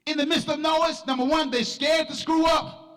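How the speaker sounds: high, driving church sermon delivery; full-force preaching. Real, not acted. Preacher exhorting the church to be different, full-force preaching. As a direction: preach it hard, stretching the phrase endings.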